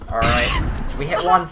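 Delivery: ordinary talk, nothing marked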